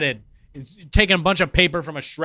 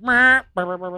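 cat meowing; 3 semitones higher than usual. Retold or imitated. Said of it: "He just goes up to you and is like".

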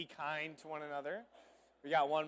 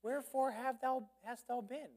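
mock gentle Mary; 9 semitones higher than usual. Preacher mocks the idea of a meek Mary, high sweet voice. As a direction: play the saintly, gentle Mary with a wink. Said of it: "kind, gentle Mary".

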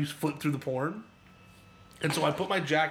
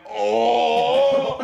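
long, loud, high acted "oh"; horrified "oh"; 7 semitones higher, 7 dB louder than usual. Man looking down into the bucket, horrified drawn-out cry. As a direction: draw out a mock cry of dismay.

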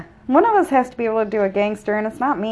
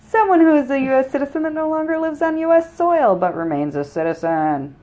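formal newscaster read, raised pitch; news anchor voice; 6 semitones higher than usual. Acted. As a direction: deliver it like a TV anchor. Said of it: "that was my news anchor voice".

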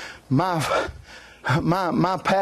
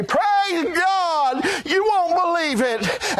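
tearful pastor; 9 semitones higher, slower than usual. Retold or imitated. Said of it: "He'd say".

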